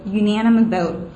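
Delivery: ordinary talk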